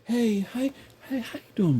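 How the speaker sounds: high, soft, timid greeting; mock a meek man saying hi; mock feminized man. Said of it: "you know how they talk?".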